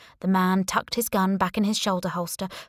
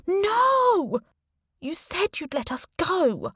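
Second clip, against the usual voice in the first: a high frantic scream of protest; 11 semitones higher, 3 dB louder, slower than usual. A narrator's reading. Niece screaming at Nathan as her aunt is threatened, high scream. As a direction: scream it out in horror. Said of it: "Auntie Claire's turn to scream".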